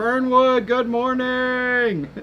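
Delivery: high, loud, drawn-out morning holler; calling out greeting. Real, not acted. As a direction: call it out long and high.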